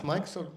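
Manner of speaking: "Mics on?" asked with a high rising end; questioning tune. Real, not acted. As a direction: check whether "Mics on?" works, as a question. Checking the microphones.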